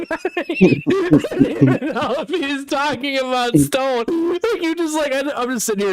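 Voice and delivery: can barely hold in laughter; laughing. Real, not acted. Recalling the friend's outburst to his mate, laughing hard.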